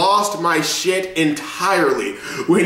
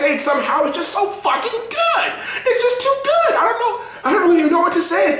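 much higher, laughing delight; laughing gush; 14 semitones higher than usual. Real, not acted. Fan gushing about the episode, high laughing delight. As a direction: laugh helplessly over how good it is.

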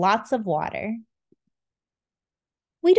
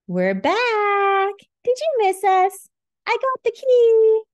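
very high, loud cheering; excited squeaky voices; 11 semitones higher, 5 dB louder than usual. Acted. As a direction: burst in triumphantly. Insulin friends return cheering.

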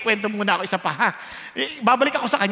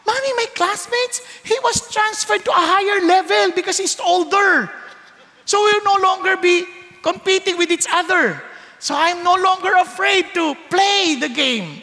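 put-on high, lively child explaining; child telling mother the bully moved up, high and eager; excited child; 7 semitones higher than usual. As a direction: tell it like a relieved kid. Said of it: "umuwi yung bata".

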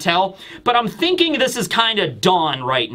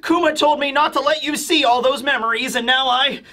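flustered Vegapunk; 7 semitones higher than usual. Retold or imitated. Scientist panicking to Quasar about leaked memories, higher flustered voice. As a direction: fret breathlessly like a panicked inventor. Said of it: "he looks out of breath".

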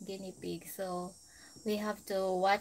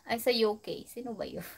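ordinary talk

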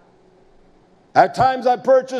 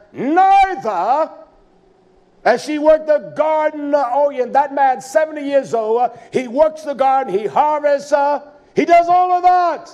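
louder, higher preaching burst; preaching climax; 5 semitones higher, 4 dB louder than usual. Real, not acted. Preacher marvelling at the old farmer, raised and loud. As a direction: lift it up and sell it to the church.

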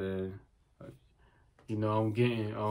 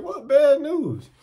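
high, drawn-out, louder disbelieving question; dumbfounded; 12 semitones higher, 5 dB louder, slower than usual. Retold or imitated. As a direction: blurt it out high, stunned by the news. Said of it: "kinda dumbfounded".

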